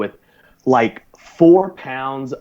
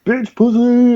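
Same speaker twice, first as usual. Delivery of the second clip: much higher, laughing taunt; mocking buddies; 13 semitones higher, much slower than usual. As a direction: jeer at him through laughter. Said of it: "started laughing their asses off".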